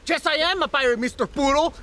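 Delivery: defiant shout. Acted. Defiant insistence he is a pirate.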